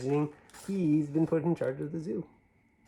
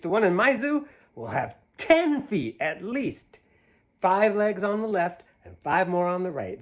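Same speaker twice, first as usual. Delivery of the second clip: higher, louder, bouncy rhyme reading; reading to children; 6 semitones higher, 5 dB louder, slower than usual. Acted. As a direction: read it big and playful for a child. Reads picture book aloud.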